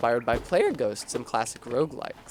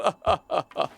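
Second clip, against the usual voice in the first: sly shaman chuckle; 4 semitones higher than usual. Acted. Character laughs after request.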